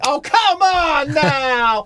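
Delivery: high, drawn-out mock cry of protest; slow, wailing plea at the mirror; hammed-up high-pitched despair; exasperated wail. Imagined bald hairy man's exasperation.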